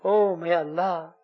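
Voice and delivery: fervent supplication, rising on each plea; heartfelt prayer. Acted. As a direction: pour out the dua to Allah, pleading. Leads an emotional dua.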